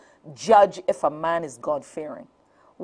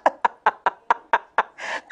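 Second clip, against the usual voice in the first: breathy laugh. Real, not acted. Laughter after the joke.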